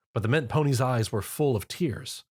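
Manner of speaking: plain reading voice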